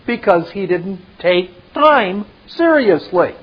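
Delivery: normal talking voice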